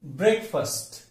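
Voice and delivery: ब्रेकफास्ट with a weak second syllable; correct pronunciation. Shown as a demonstration. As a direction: say ब्रेकफास्ट as BREK-fust. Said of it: "सही उच्चार क्या है".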